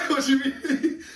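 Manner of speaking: can barely get the words out for giggling; a giggly retold comeback; laughing. Same reply repeated while laughing.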